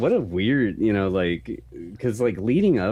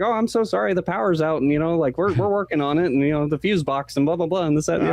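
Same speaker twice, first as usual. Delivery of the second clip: high-pitched, fussy apologetic clerk; flustered little hotel manager; 9 semitones higher than usual. Retold or imitated.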